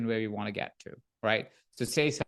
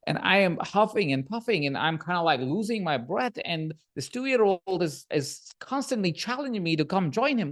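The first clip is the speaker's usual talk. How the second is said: normal talking voice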